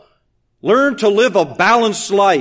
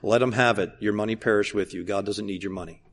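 low and very soft, letting go of the money; he repeats his advice to the feuding ministries, low and quiet; quiet dismissal; 11 semitones lower, 10 dB quieter than usual. Retold or imitated. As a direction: shrug the money off, almost under the breath. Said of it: "what I told many people".

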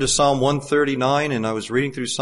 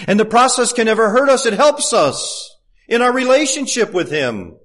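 strong, raised preaching voice; fervent preaching; 7 semitones higher, 4 dB louder than usual. Acted. Preacher exhorting brethren to love the Lord first, fervent. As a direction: press the point home from the pulpit.

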